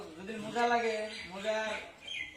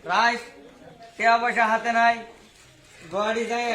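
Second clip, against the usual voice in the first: very loud declaiming, phrase by phrase; hammed-up rally shout; shouted mock oration; 12 dB louder than usual. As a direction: belt it out to the crowd. Shouted punchlines in mock speech.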